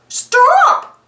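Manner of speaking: sharp, high, loud cry of alarm. Acted. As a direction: stop a friend mid-bite. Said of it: "Mickey cried".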